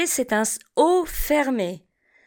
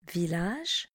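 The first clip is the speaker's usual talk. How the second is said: Village repeated much softer and lower; 5 semitones lower, 10 dB quieter than usual. Shown as a demonstration. Teacher echoes Village quietly for the class. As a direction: softly echo Village. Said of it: "Répétez après moi".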